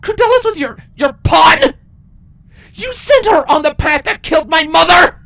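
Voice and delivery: enraged captive queen yelling; shouted in rage. Acted. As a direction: scream the threat at her. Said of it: "Twilight shouted in rage".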